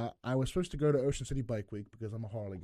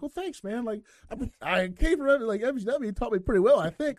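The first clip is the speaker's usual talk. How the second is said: higher, louder, excited mock thanks; 11 semitones higher, 10 dB louder than usual. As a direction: play up how chuffed you were. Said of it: "I'm like".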